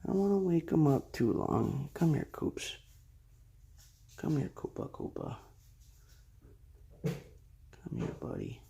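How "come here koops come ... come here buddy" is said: soft, breathy coaxing; quiet at night. Real, not acted. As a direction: gently coax the sleepy pet. Coaxing sleeping tortoise awake.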